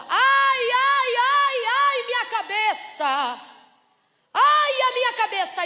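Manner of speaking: put-on high, loud wailing boy; child in pain. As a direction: cry out like a sick child clutching his head. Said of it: "E o pai dele diz assim". (menino gemendo de dor, agudo e alto)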